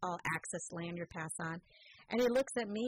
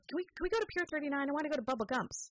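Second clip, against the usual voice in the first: put-on higher and fast, eager kid begging; eager pleading kid; 7 semitones higher, faster than usual. Mother imitating her daughter begging to visit the pier. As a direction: beg for the outing like an excited child.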